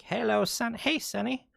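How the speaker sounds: high-pitched hammed-up old granny; badly faked old lady greeting her sonny; terrible granny voice. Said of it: "she's talking like a granny".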